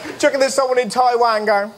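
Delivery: ordinary delivery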